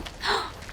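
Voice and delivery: a sharp, breathless gasp of delight; thrilled little intake of breath. Acted. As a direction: gasp as if shoes were the best news yet. Leliana excited about shoes.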